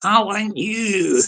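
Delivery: slow, drawn-out doorstep threat; hammed-up gangster demand; gangster at the door. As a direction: play a mock-menacing caller, stretching each word. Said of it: "the YouTube gangster is in the outside".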